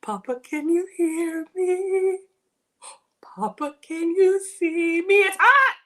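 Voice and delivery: high, slow hammed-up plea; mock call to heaven. Hammed-up call to Papa while tasting hot food. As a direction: cry out to Papa as if he's above.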